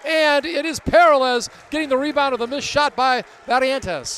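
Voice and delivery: loud, high-pitched live game call; urgent basketball commentary riding every bounce; excited play-by-play. Real, not acted. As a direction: call the scramble at full voice, building to the rebound.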